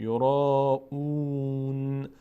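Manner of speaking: "یورا اون" slowly, long aa then long oo; madd and long oo. Shown as a demonstration. Teacher reads the verse-final word, elongated vowels. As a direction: draw out both vowels of "یورا اون", closing on N.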